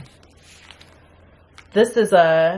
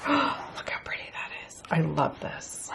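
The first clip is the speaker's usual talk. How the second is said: awed whisper; 3 semitones lower, 11 dB quieter than usual. Real, not acted. Gasps at pretty paper.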